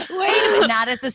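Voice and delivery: giggling wait a minute; laughing protest. Real, not acted. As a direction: laugh it out, caught off guard. Laughing at being teased.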